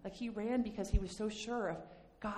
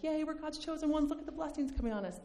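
smug mock cheer; 8 semitones higher than usual. Mocking smug Israel.

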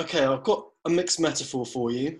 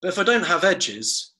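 measured poetry recital; poem reading. Acted. Host reciting his comic poem to the co-host, poetry voice. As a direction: read each couplet with a pause. Reads second short poem.

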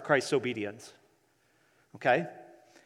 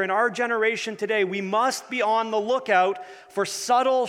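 preaching; 5 semitones higher than usual. Acted. Preacher raising intensity.

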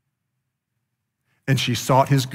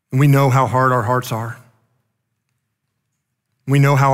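earnest prayer; 4 dB louder than usual. Acted. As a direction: pray to the Father, earnest and steady. Leads closing prayer.

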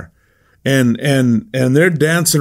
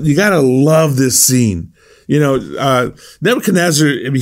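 ordinary delivery

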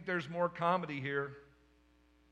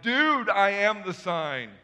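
loud, slow, fed-up angel retort, louder; exasperated angel; 4 semitones higher, 10 dB louder, slower than usual. Retold or imitated. Gabriel answers Zechariah's demand for a sign, exasperated and slow. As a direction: spell it out to the doubter. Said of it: "Gabriel's like".

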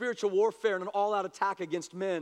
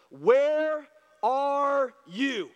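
slow, drawn-out, louder call; God calling to the hiding man, slow and loud; 4 dB louder, much slower than usual. Acted. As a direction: stretch it out like a voice searching the garden.

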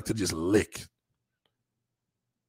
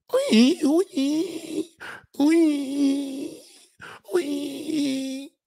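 very high, repeated mock squeals; hammed-up high-pitched cries; 14 semitones higher, much slower than usual. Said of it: "different nationalities I can't deal with".

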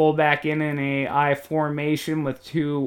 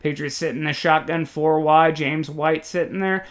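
ordinary talk, nothing marked

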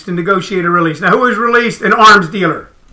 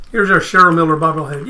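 ordinary delivery

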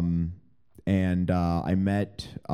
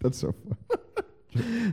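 laughing; 11 semitones higher, 4 dB quieter, much slower than usual. Real, not acted.

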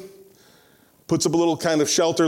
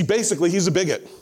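usual speaking voice

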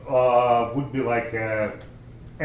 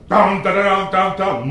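loud, raised "dum-da-da" fanfare; mock fanfare; 3 semitones higher, 7 dB louder than usual. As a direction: belt out a showy entrance flourish. Mimes the showy entrance he didn't make.

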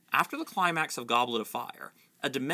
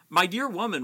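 mild mock roar, higher; 6 semitones higher, 4 dB louder than usual. Fudge rounding on McGonagall, mild roar. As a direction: puff up and bark it lightly. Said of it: "roared Fudge".

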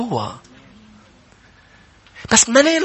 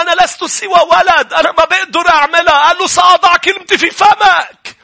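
preaching at full force; 4 semitones higher than usual. Acted. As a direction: build it up like a fiery preacher.

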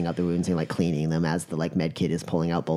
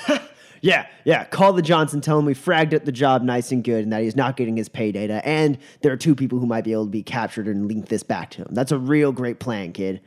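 louder, higher sarcastic scoff; mock the plan with scorn; sarcastic mockery; 4 semitones higher, 4 dB louder than usual. Godsmack sarcastically mocks.

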